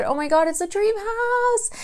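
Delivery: high squeal of mock excitement; excited gush. Buyers finding their dream house, mock excited. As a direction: gush like a thrilled homebuyer. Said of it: "everyone's excited".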